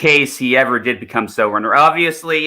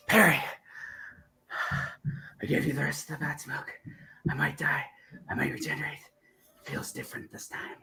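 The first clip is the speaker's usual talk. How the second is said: much softer, fading to a whisper; dying Doctor; 4 semitones higher, 13 dB quieter than usual. Acted. Acting a failing regeneration for a friend, fading whisper. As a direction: let the life drain out of the voice. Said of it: "the seventh".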